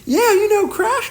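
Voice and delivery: put-on very high, slow, loud girlish voice; girl's reply. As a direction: voice the girl high and drawn out.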